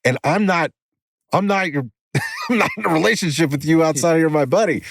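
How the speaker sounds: high-pitched and laughing; laughing disclaimer. Real, not acted. Host joking to his buddy about their relationship, laughing. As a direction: protest through a laugh.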